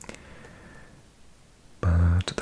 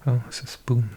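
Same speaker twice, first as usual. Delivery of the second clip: ordinary talk, nothing marked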